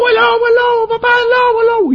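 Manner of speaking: grito agudísimo y fuerte de niño asustado; niño gritando alarma. Acted. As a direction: chilla pidiendo socorro a papá. Niño grita falsa alarma a papá. (niño pastor gritando en falsete)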